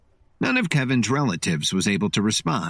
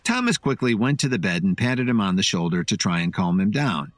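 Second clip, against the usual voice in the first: plain reading voice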